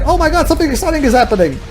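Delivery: high, quick burst of acted thrill; mock excitement. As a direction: gush it fast and high, hamming up the thrill. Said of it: "and I'm like".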